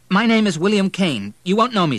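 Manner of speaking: high, loud, pressing introduction; anxious caller. A narrator's reading. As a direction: introduce yourself under pressure. Frantic son phones the doctor.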